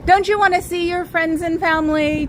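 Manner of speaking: ordinary delivery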